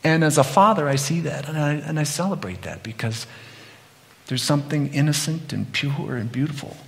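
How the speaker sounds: ordinary talk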